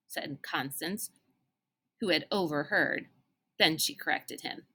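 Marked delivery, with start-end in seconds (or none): none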